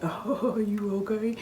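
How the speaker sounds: high, loud, giggly mock concern; cousin laughing. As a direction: imitate someone laughing through the question. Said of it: "So my cousin laughing".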